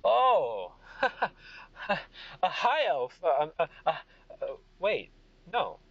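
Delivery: high, chuckling, then stumbling; amused king. Acted. King noticing a high elf, amused. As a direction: exclaim high, laugh and falter. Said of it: "he looks towards".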